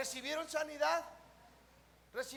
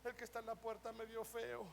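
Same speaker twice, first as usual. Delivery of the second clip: mimicked excuse-makers; 2 semitones lower, 7 dB quieter than usual.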